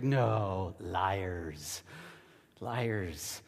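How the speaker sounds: low, drawn-out teasing charge; mock accusation. Preacher teases audience about scolding their kids, mock accusing. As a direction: playfully call out the fibbers.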